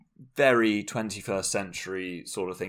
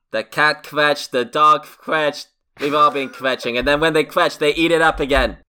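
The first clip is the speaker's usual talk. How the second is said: ranting New York mother; 7 semitones higher, 8 dB louder than usual. Retold or imitated. Mother ranting that everyone kvetches, loud high mock rant. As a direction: let the mock mom spiral into a loud rant. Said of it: "maybe the mother is worried".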